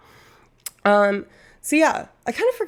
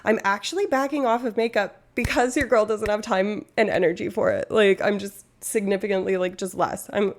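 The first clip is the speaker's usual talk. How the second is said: ordinary talk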